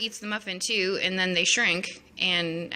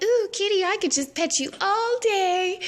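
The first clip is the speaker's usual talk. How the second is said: put-on much higher, louder baby-talk coo; squealing Alice; 14 semitones higher, 3 dB louder than usual. Alice cooing at the Cheshire Cat, high squeal. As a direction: gush like she's met a kitten. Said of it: "she's like".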